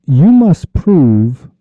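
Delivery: "must" stretched out long and high; emphatic demand. Acted. Ruler confronting the official over a hungry man, emphatic demand. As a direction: draw out MUST as an insistent order.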